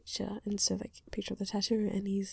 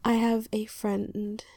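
usual speaking voice

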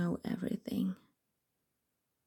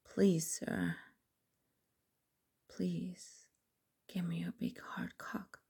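pleading; 6 dB quieter, slower than usual. Acted. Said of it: "It is easy at first".

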